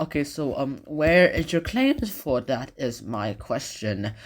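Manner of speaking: ordinary talk, nothing marked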